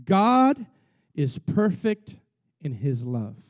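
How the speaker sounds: a slow declaration that sinks to a low hush at the end; slow emphatic sermon line. Acted. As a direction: lean on God and stretch is perfect. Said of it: "this is key".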